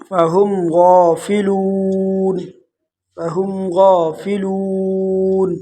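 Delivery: slow chanted stop; melodic recitation. Shown as a demonstration. Teacher chants and stops on the noon.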